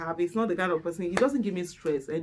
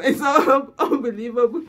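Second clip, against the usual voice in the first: words half lost in loud laughter; laughing; 6 semitones higher, 6 dB louder than usual. Real, not acted. Wife laughing on camera, laughing. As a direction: still laughing hard. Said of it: "I normally laugh with reckless abandon".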